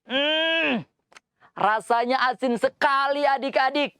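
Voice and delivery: high, hammed-up salty-taste reaction; reading to a toddler. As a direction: pull a face at the salty taste. Tastes salt, reacts for kids.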